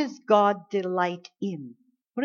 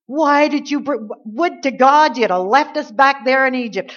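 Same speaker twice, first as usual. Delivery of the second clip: higher, louder indignant protest at God; angry complaint; 4 semitones higher, 5 dB louder than usual. Acted. Angry Israelites demanding why God led them out, indignant. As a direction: rail at God in loud, raised-pitch frustration. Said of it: "They were very angry".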